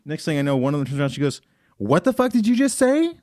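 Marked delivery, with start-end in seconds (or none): 1.8-3.2 high-pitched mock indignation, rising at the end; indignant confrontation. Offended girl confronting him over his joke, indignant imitation. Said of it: "Like that to me".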